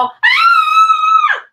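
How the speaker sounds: high, loud panic cry; panicked scream. Shown as a demonstration. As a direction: shriek in alarm. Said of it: "Don't go".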